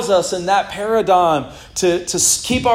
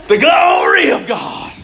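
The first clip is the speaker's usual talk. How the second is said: booming preacher shout, pitched high; hammed-up high, loud pulpit cry; T D Jakes; 13 semitones higher, 6 dB louder than usual. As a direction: thunder it out like a revival preacher. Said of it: "somebody like T D Jakes starts preaching on glory".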